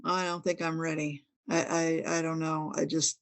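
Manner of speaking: mildly fearful, quietly backing away with halting restarts; reluctant, scared admission. Retold or imitated. Answering the regressionist's question about the truth, quietly reluctant. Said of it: "I was scared to find out".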